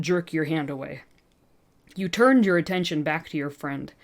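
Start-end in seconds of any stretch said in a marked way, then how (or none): none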